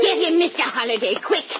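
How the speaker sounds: quick, high, urgent plea for help; urgent cry. Acted. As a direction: hurry him on in a panic. Old lady urging during scuffle.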